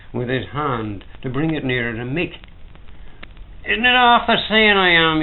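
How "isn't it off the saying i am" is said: high, loud and insistent; louder, higher, pressed out; emphatic. A narrator's reading. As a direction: lay it down hard on the barman's point. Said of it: "he said emphatically".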